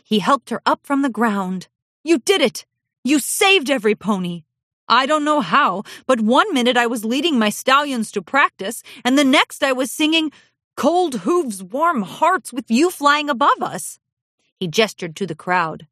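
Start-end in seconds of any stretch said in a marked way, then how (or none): 2.0-14.0 higher, louder joyful praise; excited husband. Acted. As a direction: gush with relief to your wife. Shining Armor relieved, excited.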